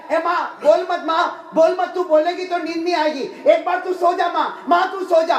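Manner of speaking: high, loud, urgent coaxing; insistent pleading. Retold or imitated. Son stroking his mother's head so she sleeps, insistent pleading. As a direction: beg her loudly to stop and sleep.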